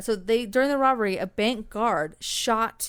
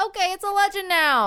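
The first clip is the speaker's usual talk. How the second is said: mock hotel staff; 10 semitones higher, 5 dB louder than usual. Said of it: "they're like".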